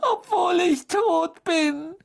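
slow, very high sobbing wail; choked with tears. A narrator's reading. Ghost insists on her feelings, high tearful sobbing. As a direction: sob it out as a wounded ghost. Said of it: "mit tränenerstickter Stimme".

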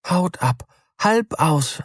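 high, musing mouse voice; wondering mouse. A narrator's reading. Mouse musing on the names, high and wondering. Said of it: "antwortete die Maus".

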